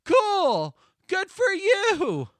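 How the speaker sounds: loud, high and very slow sarcasm; mock-cheerful brush-off to a friend; sarcastic. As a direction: drag it out, dripping with sarcasm. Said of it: "And I'm like".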